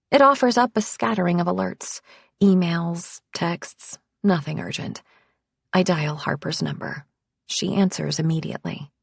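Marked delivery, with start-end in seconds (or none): none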